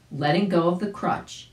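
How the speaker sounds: ordinary delivery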